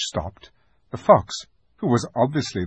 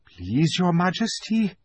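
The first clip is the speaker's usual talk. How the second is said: timid plea; 7 semitones higher than usual. Acted. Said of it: "said the fox".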